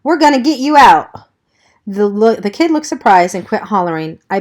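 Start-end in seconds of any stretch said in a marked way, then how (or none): 0.0-1.1 put-on high, loud yell at a screaming kid. A narrator's reading. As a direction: bark it over the noise, high and loud. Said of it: "and Johnny yelled".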